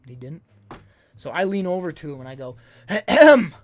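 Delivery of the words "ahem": loud, high throat-clearing; pointed ahem. Retold or imitated. Leaning over to the man on his phone, pointed ahem. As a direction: clear your throat loudly to get someone's attention.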